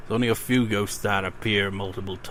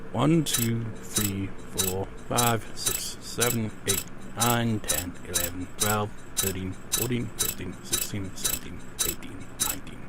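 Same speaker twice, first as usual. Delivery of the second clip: fading eerie count; 7 dB quieter than usual. Acted.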